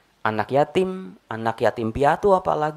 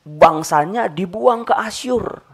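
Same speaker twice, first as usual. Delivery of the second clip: ordinary delivery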